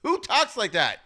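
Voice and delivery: high, loud, incredulous objection; worked-up sports host scoffing at a quote; incredulous rant. Real, not acted. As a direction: push up high in disbelief. Ranting at coach's quote.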